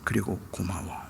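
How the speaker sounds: soft, low, halting son's voice; hushed, hesitant thanks and apology, retold; subdued apology. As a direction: apologize quietly with long pauses between phrases.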